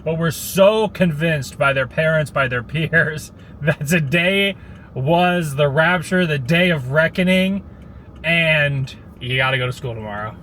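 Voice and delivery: ordinary delivery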